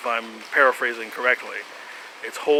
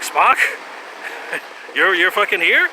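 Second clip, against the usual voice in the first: higher and louder disbelieving shout; startled "he's here?!" reaction; surprised crew; 6 semitones higher, 4 dB louder than usual. Retold or imitated. Crew greeting Spock's return, surprised and loud. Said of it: "like, surprised".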